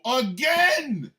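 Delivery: loud, high exasperated cry; drawn-out exasperated yell; exasperated outburst. Real, not acted. Exasperated at a repeat attendee.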